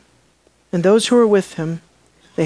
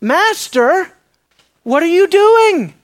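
higher, louder frustrated outburst at Jesus; Peter yelling; 9 semitones higher, 5 dB louder, slower than usual. Acted. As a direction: Peter scolding his master in exasperation. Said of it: "Peter's yelling at him".

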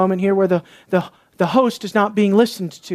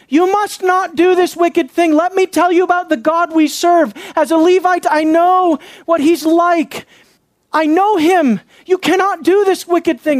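forceful protest; 8 semitones higher, 3 dB louder than usual. Acted. Acts out imagined Levite's protest.

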